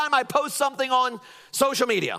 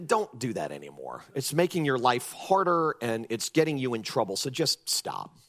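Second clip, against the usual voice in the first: much lower, softer gentle plea; tender plea; 8 semitones lower, 5 dB quieter than usual. Retold or imitated. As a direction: coax her kindly to stop. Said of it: "He's saying".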